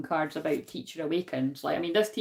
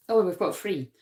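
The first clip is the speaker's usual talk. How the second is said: ordinary talk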